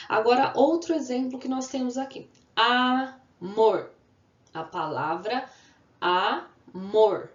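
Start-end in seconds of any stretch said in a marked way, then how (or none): 2.5-3.9 Amor loud, as a long A, then mor; syllable by syllable. Shown as a demonstration. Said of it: "duas vezes". 6.0-7.3 amor split loudly into a, then mor; syllable by syllable. Shown as a demonstration. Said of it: "duas vezes".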